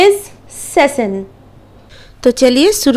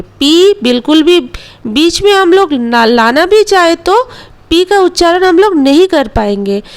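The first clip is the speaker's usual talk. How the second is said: ordinary delivery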